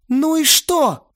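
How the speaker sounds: high, loud, drawn-out protest; defiant wail. A narrator's reading. Lena wails back at Vova, high and drawn out. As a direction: throw it back at him, stretched.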